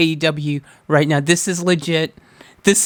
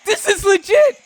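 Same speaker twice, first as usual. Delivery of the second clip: excited; 12 semitones higher than usual. Real, not acted. Overjoyed reaction.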